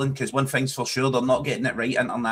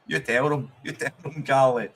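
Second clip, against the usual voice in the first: ordinary delivery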